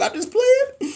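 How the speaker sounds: high, laughing through the words. Real, not acted. Teasing livestream viewers after a slip, laughing. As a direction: giggle while taking it back.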